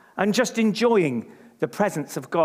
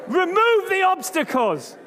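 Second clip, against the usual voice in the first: "Remove the obstacles" called high and strong; prophesy it boldly; emphatic response; 9 semitones higher than usual. Shown as a demonstration. Preacher modelling the response, emphatic call. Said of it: "a bit more emphatic about this".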